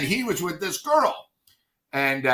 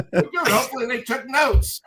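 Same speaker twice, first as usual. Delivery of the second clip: laughing retort; 6 semitones higher than usual. Retold or imitated. Smug retort while laughing.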